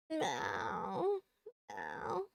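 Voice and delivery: playful meow at chat; cat meow. Pretend. Streamer meowing to be annoying on purpose, playful cat cry. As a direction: meow like an attention-seeking cat. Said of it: "Sorry. Being annoying".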